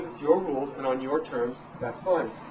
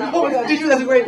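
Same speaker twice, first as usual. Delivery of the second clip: much higher and louder, swept along; caught-up excitement; 9 semitones higher, 9 dB louder than usual. Retold or imitated. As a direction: get sucked into her hype. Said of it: "I'm just like".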